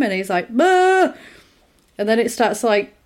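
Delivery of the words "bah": high, loud cry of fright; startled mock yelp. Boy sees the photo wink at him, mock yelp. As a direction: yelp high, imitating sudden shock. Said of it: "it winks at him".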